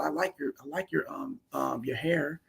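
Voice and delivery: soft, hesitant, timid compliment; a hint of a nervous, mumbled compliment; mocking a weak compliment. Shown as a demonstration. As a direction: say it shyly, like a man afraid of her. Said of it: "don't call it out".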